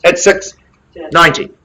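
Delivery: rally the room for opening bids; auction calling. Real, not acted.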